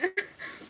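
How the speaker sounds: high laugh through tears; teary laugh. Real, not acted. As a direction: laugh off the crying. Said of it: "I'm definitely crying".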